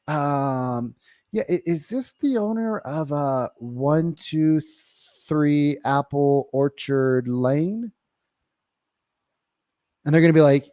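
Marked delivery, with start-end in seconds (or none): 0.1-7.9 very slow, hesitant cold-call opener; curious. Shown as a demonstration. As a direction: ask tentatively, drawing the words out with pauses. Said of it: "Very curious, very slow".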